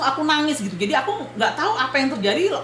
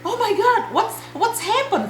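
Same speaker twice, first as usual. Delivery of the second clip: high-pitched retold shock; 9 semitones higher than usual. Telling viewers what she said after the crash, shocked. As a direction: act out stunned disbelief, voice jumping up. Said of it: "Saya bilang gitu kan. Aku terkejam-kejam".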